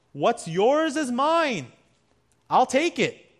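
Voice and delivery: high, loud, slow grabbing voice of a thief; greedy thief. Retold or imitated. Preacher voicing a thief's attitude, high, loud, grasping. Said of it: "That's the thief".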